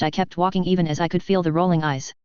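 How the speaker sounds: usual speaking voice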